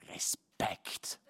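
whispered hiss. Acted. Street sweeper hisses approval at him, whispered. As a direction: hiss it with a thumbs-up. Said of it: "zischt das Wort".

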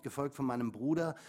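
ordinary talk, nothing marked

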